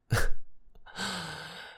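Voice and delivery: bright amused laugh; high teasing laugh. Acted. Laughing at own joke.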